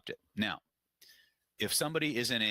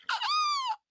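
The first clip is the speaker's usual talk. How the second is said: nervous laugh; 5 dB louder than usual. Retold or imitated. Faced by furious huge bodybuilder.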